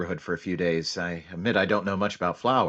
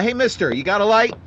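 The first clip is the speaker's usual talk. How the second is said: higher, louder call down the street; 13 semitones higher, 8 dB louder than usual. Acted. Undercover cop calling to a man on the sidewalk, loud friendly call. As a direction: hail a stranger from the car.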